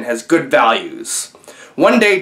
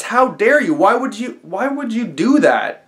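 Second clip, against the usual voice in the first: raised, higher-pitched indignant demand; retold anger; 8 semitones higher than usual. Joe scolding the father on the bus, higher, mock-indignant. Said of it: "Joe was a little bit angry".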